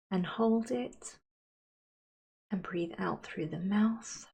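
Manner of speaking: unhurried, soothing visualisation, softening at phrase ends; guided meditation. Acted. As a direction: guide them gently into calm, leaving space to breathe.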